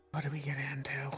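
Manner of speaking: sighing whisper; whispered aside. Acted. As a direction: mutter it under your breath.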